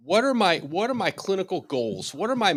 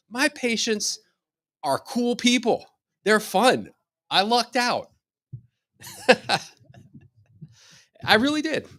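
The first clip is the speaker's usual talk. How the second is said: much higher, beaming with delight at his patients; 9 semitones higher than usual. Real, not acted. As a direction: gush happily, breaking into a laugh. Gushing about his lucky clientele.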